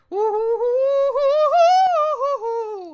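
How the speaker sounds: high, loud, drawn-out howling; wolf howl. Retold or imitated. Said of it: "So it's like".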